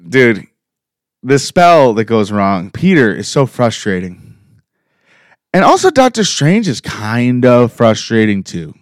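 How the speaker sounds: ordinary delivery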